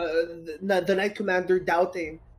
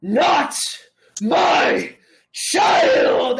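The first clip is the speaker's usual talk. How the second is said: booming godlike shout; echoing from the heavens; 6 semitones higher, 7 dB louder, much slower than usual. Acted. Game master voices a heavenly echo, thundering shout. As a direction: shout it, each word thundering on its own. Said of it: "echoing from the heavens once more".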